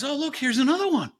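lightly hammed-up frost spirit, quick and amused; Morozko gleeful. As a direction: spot the next victim with delight. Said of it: "Morozko comes along and says".

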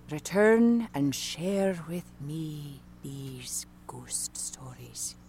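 slow, hushed Irish storyteller; soft Irish voice fading to a whisper; Irish accent. Acted. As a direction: tell it slowly and quietly in an Irish accent. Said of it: "we'll do an Irish".

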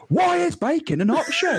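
mock-indignant shout; mock outraged shout. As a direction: ham up a furious customer through laughter. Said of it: "staring at the guy going".

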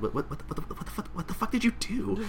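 high, hushed, stammering question; soft and high, tripping over the words; acted alarmed aside. Clerk asks coworker about mad customer, hushed acted alarm.

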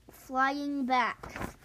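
usual speaking voice

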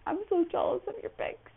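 high, gushing fake envy; lightly voiced jealous friend; mock jealous. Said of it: "I'm just like".

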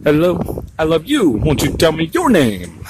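sing-song line broken down, "your" high and drawn out; emphasized YOUR. Shown as a demonstration. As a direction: break it up and swoop high on "your". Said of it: "had to break it down".

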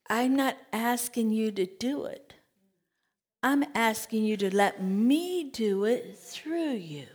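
soft whispered divine reply; hushed voice of God. Acted. God answering the doubting preacher, hushed whisper. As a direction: murmur it like a secret promise.

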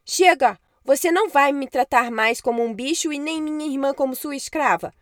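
loud, defiant shout; angry shout. Acted. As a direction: stand up to her, furious. Boy rebels against witch.

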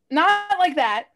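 NOT hit high and loud, drawn out; firmly stamp on the word NOT; emphatic correction. Real, not acted. Hastily corrects a suggestive misunderstanding.

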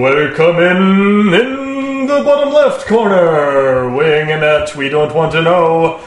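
loud, drawn-out boxing announcer shout; ring announcer. Pretend. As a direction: bellow a fighter introduction, stretching the words. Opens show as ring announcer.

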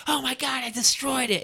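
hammed-up delighted self; mock elation. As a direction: gush about crushing the set. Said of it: "I would be like".

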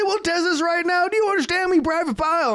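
high, loud sergeant demand; chewing out. Retold or imitated. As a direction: imitate a drill sergeant challenging a recruit. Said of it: "chewing him out".

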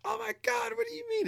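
very high-pitched mock shriek; hammed-up falsetto outrage; mock outraged people. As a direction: squeal it like a scandalized complainer. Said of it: "people were like".

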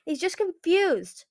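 high, slow, urgent plea; yelled plea. A narrator's reading. Winter defends his confused brother, yelling a plea. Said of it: "Winter yelled".

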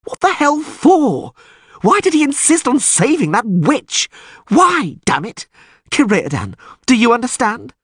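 very high, anguished lament; distraught. A narrator's reading. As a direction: plead for an answer in exasperation. Dandelion's groaned lament continues.

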